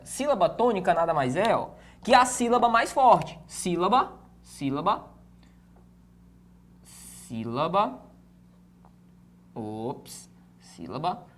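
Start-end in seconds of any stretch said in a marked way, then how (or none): 3.5-11.3 soft, low, drawn-out syllables; writing-along voice. Real, not acted. Teacher spelling out a term while writing it, softly drawn out. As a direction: murmur it slowly, pitch rising at the end.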